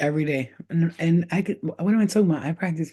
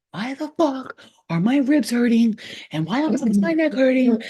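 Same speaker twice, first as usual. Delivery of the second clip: higher, louder mock complaint; retold yelling; 8 semitones higher, 3 dB louder than usual. She retells yelling about her aching ribs, mock loud. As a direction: replay yelling about the pain. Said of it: "And I'm yelling".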